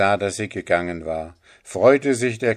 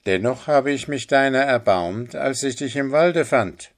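vorwurfsvoller Holzfäller; 5 semitones higher, 4 dB louder than usual. Acted.

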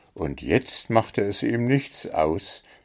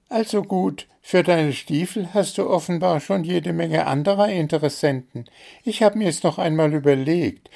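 small bird voice; 9 semitones higher than usual. A narrator's reading. Zwitschi changes his request.

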